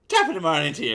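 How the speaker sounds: put-on cheeky stage-Irish accent. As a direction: belt out a hammed-up Irish brogue. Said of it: "I'm gonna go there".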